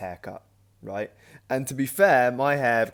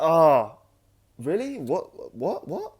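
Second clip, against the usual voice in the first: higher-pitched, startled string of questions; startled disbelief; 5 semitones higher, 4 dB louder, much slower than usual. Retold or imitated. Storyteller replaying his reaction to a stranger's earthquake claim, startled. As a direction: play up the baffled surprise.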